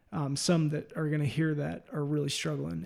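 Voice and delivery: ordinary talk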